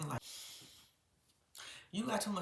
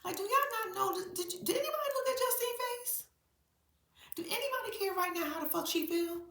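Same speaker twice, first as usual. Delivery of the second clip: retold outrage; 9 semitones higher than usual. Defending pregnant Justine at the table, high indignant rant. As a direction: scold the whole table, outraged. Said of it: "I was like".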